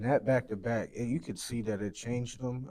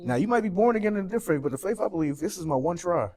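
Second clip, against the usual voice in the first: higher, louder and fast at first; impassioned rant; 7 semitones higher, 5 dB louder than usual. Real, not acted. Grieving guest's host insists life has one try, fired up and loud. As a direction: drive each point home. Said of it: "and this is a little rant".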